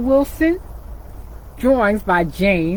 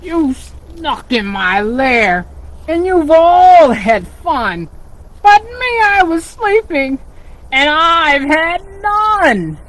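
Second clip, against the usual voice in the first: loud, grumbling bear complaint; cranky bear, louder and slow; grumpy bear; 5 semitones higher, 6 dB louder than usual. Acted. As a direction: scold them like a grumpy woken bear. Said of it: "bear grumbles".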